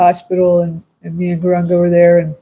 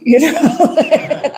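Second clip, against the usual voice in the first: laughing through the words; 8 semitones higher than usual. Real, not acted.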